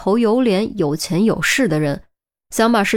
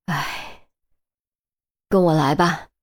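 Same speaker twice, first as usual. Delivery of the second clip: sighing; 3 semitones lower, 4 dB quieter than usual. Acted. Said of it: "叹了口气".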